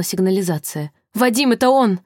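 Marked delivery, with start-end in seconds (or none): 1.1-2.0 high, contained squeal of alarm; alarmed shriek. A narrator's reading. Sasha hears the kidnapper returning, alarmed shriek to Vadim. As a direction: cry the warning in a raised pitch. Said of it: "взвизгнула Саша".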